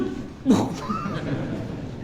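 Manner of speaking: a high, airy burst of laughter; high breathy laugh. Real, not acted. Preacher laughs at the audience, high and airy. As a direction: laugh lightly at your own question. Laugh after rhetorical question.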